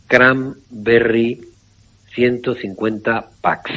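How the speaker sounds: very slow, each word set apart for listeners; slow dictation. Shown as a demonstration. Radio doctor dictating the product name to listeners, very slowly. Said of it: "Cranberry con B".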